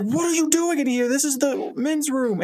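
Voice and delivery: high-pitched, scandalized squawk; alarmed protest. Acted. Craig to ex-wife bursting into men's bathroom, high alarmed protest.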